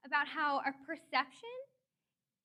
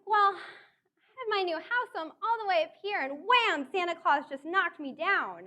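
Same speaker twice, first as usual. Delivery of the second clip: excited joking reply; 4 semitones higher, 8 dB louder than usual. Retold or imitated. Her joking reply to delivery man.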